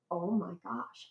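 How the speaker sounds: soft, understated retold gasp of wonder; hushed amazement. Researcher recalling her find in the archives, hushed amazement. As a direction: say it under your breath in awe.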